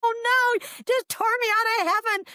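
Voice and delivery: very high-pitched comic wail; hammed-up protest, voice way up top; mock dismay. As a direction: scold the rescuers in exaggerated despair. Acting out dismay at being revived.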